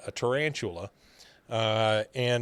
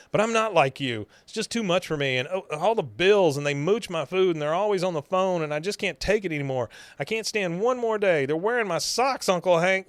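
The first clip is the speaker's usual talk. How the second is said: higher-pitched mock distressed complaint; upset niece; 6 semitones higher than usual. Niece unloading on her uncle about housemates, high and upset. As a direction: pile up the grievances, voice climbing higher. Voicing Luann's outburst to Hank.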